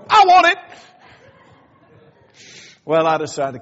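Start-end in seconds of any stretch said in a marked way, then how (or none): 0.1-0.6 high loud laughing "I want it"; eager listener. Retold or imitated. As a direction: blurt it out like a greedy kid. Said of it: "Just go".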